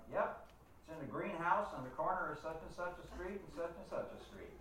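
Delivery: high-pitched Southern imitation; Southern accent. Dowser phoning back with the location, Southern accent. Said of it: "in this heavy Southern accent".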